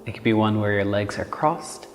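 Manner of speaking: calm, unhurried meditation voice with long pauses; guided meditation. Acted. Yoga teacher leading listeners through Nadi Shona breathing, calm guided meditation. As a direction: gently guide them through each breath, letting phrases settle.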